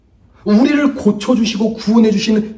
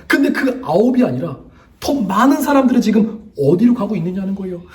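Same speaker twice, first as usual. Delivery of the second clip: ordinary talk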